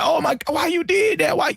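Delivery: high-pitched imitated whine; mock whining. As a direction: whine it like a hurt complainer. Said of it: "he was whining".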